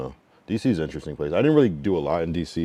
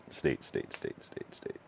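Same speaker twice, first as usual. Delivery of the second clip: fading repeat; 8 semitones lower, 14 dB quieter, much slower than usual. Real, not acted.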